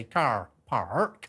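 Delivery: Lancashire rolled-out R in "Car Park"; Lancashire accent. Shown as a demonstration. Radio host showing how Lancashire says R, strong Lancashire accent. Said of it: "it's the way we say R".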